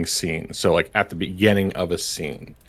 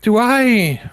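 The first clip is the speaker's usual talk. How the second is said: high NPC voice; 13 semitones higher, 5 dB louder than usual. Acted. NPC warlock addresses player.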